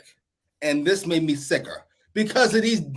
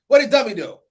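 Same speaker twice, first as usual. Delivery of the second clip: ordinary talk, nothing marked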